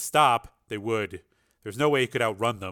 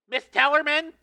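much higher, urgent caller voice; tense man on phone; 6 dB louder than usual. A narrator's reading. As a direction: ask anxiously down the line.

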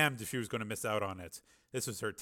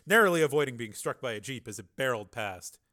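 plain reading voice

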